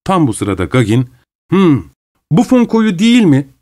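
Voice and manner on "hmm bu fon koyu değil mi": called out. A narrator's reading. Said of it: "diye bağırdı".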